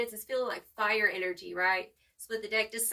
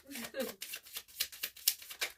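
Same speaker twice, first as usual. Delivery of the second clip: soft breathy laugh; 8 dB quieter than usual. Real, not acted. Laughing at the card.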